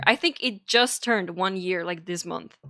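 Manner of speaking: ordinary delivery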